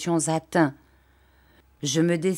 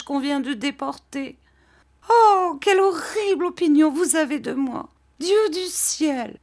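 tearful lament; 10 semitones higher, 4 dB louder than usual. Acted. Dora reproaching her husband while crying, much higher. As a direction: wail your hurt at him through tears. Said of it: "elle pleurait de plus belle".

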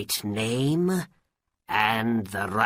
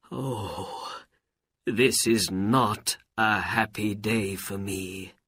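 grieving; 3 semitones higher than usual. A narrator's reading. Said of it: "and began to cry".

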